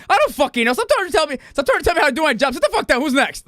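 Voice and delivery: high, loud, fast outburst of a fed-up guard captain; mock angry rant. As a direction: snap at them like a furious boss who's had enough.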